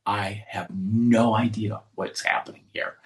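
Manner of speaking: baffled aside. Retold or imitated. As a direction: imitate a slow, helpless aside to onlookers. Baffled aside over crying woman's shoulder.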